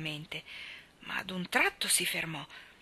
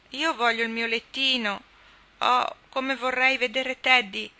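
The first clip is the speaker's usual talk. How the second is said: put-on higher, louder and slow, a small boy wailing for home; homesick little boy crying out; 5 semitones higher, 6 dB louder than usual. A narrator's reading. Rob, lost at night, cries out for his bed. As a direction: whine it out loud like a tired child. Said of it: "gridò Rob".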